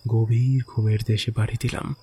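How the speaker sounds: usual speaking voice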